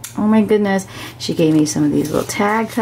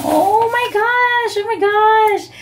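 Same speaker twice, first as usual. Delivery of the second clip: much higher, slow, drawn-out gasping delight; excited squeal; 12 semitones higher, slower than usual. Real, not acted. Reading her friend's private birthday note, high excited squeal. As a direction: let the joy burst out high and stretched.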